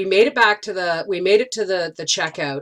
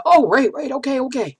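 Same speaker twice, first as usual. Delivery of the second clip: flustered realization; 7 semitones higher than usual. Retold or imitated.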